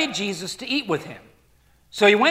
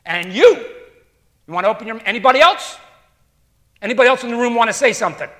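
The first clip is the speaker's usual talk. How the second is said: loud, fast, daring challenge; Jesus challenging the room; 2 semitones higher, 6 dB louder, much faster than usual. Pretend. Jesus turning on the lawyer, loud, fast and challenging. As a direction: dare anyone else to speak up.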